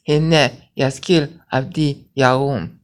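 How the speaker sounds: measured Hebrew cadence, syllable by syllable; slow cadenced reading. Shown as a demonstration. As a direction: chant "Hine yaskil avdi yarum" slowly, giving each syllable its own beat. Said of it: "see the cadence".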